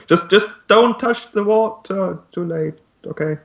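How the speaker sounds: high-pitched call without much volume; half-hearted shout that softens at the end; mock shouting. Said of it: "you hear Nighttail shouting".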